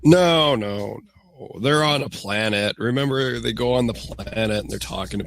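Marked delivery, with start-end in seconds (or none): none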